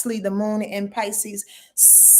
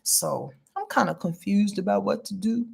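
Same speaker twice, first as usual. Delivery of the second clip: ordinary talk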